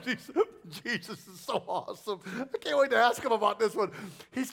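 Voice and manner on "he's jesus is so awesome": ordinary delivery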